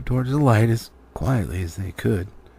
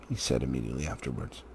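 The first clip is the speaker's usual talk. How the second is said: plain reading voice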